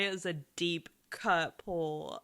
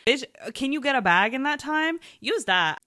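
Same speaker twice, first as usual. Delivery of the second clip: louder, higher, sassy telling-off; sassy louder lecture to viewers; loud scolding; 4 semitones higher, 8 dB louder than usual. Real, not acted. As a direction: snap them into line with sass.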